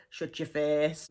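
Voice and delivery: FACE with an open vowel, "fairce". Shown as a demonstration. Teacher quotes what people say, FACE as "fairce". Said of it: "It's the way it's pronounced".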